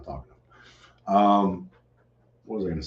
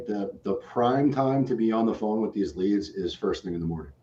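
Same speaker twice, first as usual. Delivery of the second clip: ordinary talk, nothing marked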